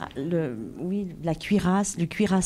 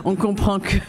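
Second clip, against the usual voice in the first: laughing; 4 semitones higher than usual. Real, not acted. Interpreter laughs mid-translation.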